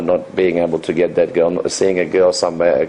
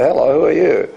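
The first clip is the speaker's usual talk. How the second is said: higher, bouncy pickup greeting; playful opener; 7 semitones higher than usual. Shown as a demonstration. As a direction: toss it out cheekily, like flirting. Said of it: "that's how I say".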